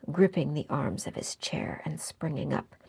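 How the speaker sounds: ordinary delivery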